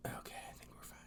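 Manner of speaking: hushed aside to the room; whispering. Real, not acted. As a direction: whisper it off-mic. Checking if camera is off.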